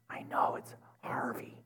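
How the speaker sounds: breathy whisper, voice dropped right out; whispered insistence. Retold or imitated. To the drive-through girl, a hushed whispered insistence. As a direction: whisper it like a stubborn secret.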